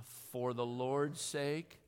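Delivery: very slow, drawn-out Lord's; very slow final recital. Shown as a demonstration. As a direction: end the chant slowly and rising. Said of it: "One more time".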